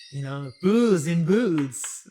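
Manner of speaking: slow, put-on Spanish accent; Spanish film-star accent; Antonio Banderas. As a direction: draw it out in a mock Spanish accent. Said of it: "I would like to have Antonio Banderas".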